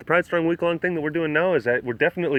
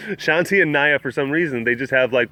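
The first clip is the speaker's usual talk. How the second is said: ordinary delivery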